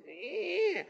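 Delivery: put-on high whiny grunt of a kid; mock a child's whine. Preacher imitating a whining child, a high whiny eh. Kids whining when not perfect.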